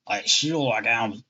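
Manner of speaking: put-on old sailor voice, slow; slow gruff old seaman; seafarer voice. Shown as a demonstration. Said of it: "What was my seafarer voice?".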